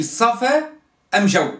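صفا أم جو, the article ال said as أم; dialect pronunciation demo. Shown as a demonstration. Teacher showing students an old tribe's speech, carefully pronounced. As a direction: say صفا أم جو with أم in place of ال. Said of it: "تبدل اللام بميم".

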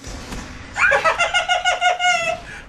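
very high, long cackling laugh; gleeful cackle. Real, not acted. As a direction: cackle with triumph. Gleeful at multiple kills.

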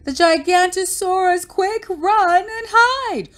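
high, loud drawn-out warning; high-pitched acted alarm call; crying out in alarm. A narrator's reading. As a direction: yell the warning across the hill. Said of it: "Bonehead cried".

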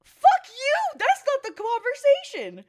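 very high, loud cursing outburst; furious yell. Retold or imitated. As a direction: scream it right at him. Imagined furious reply to ex.

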